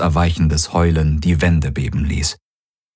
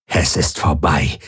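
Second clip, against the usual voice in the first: low alien growl; a lightly voiced low growl of a warrior; 4 semitones lower than usual. A narrator's reading. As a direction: growl it out, final. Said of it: "knurrte die Aur".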